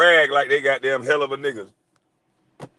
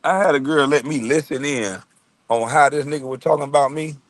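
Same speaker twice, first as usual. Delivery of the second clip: ordinary talk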